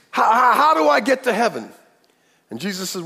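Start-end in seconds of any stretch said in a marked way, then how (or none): 0.1-1.7 put-on pleading young man. Rich young man pleading with Jesus.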